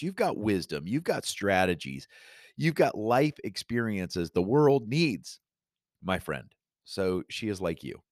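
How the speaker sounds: normal talking voice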